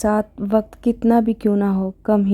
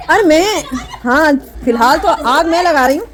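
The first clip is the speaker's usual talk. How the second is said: laughing; 8 semitones higher, 6 dB louder than usual. Real, not acted. Teasing sisters-in-law by the stove.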